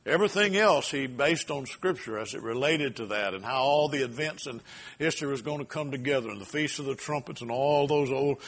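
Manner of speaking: normal talking voice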